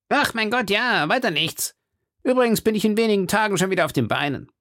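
high-pitched musketeer character voice; Porthos. Acted. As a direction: airily brush it off in a high voice. Porthos's voice in read story.

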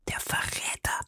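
hushed, voiceless whisper. A narrator's reading. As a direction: whisper it under your breath. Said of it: "flüsterte Madame Bonacieux".

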